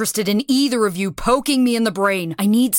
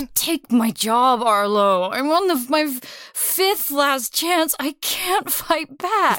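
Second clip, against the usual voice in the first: higher, slow and pleading; distressed plea; 6 semitones higher than usual. Acted. Commander pleading with the captain, higher and slow in distress. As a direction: let the panic crack through, slowly.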